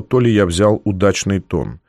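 plain reading voice

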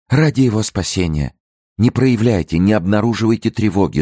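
high-pitched, imploring tone; urgent pleading. A narrator's reading. Madame de Bellière imploring the wife, pleading. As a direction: beg her to hide the alarm.

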